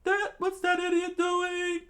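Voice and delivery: very high squeaky falsetto; put-on voice far above your own; high-pitched onlooker voice. Acted onlooker in the story.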